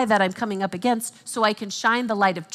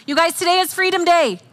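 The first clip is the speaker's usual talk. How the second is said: excited announcement; 7 semitones higher, 5 dB louder than usual. Real, not acted. Announcing the day's theme.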